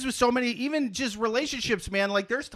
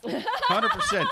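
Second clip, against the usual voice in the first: excited agreement; 14 semitones higher, much slower than usual. Real, not acted.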